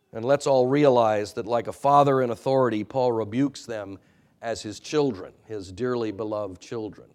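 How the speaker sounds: normal talking voice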